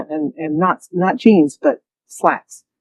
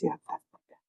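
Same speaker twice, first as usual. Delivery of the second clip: crying whisper; 9 dB quieter, faster than usual. Retold or imitated. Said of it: "he starts crying".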